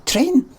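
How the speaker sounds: "train" high and short with a pure e; proper pronunciation. Shown as a demonstration. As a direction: insist on a clean "trehn" for "train". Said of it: "I'm saying it properly".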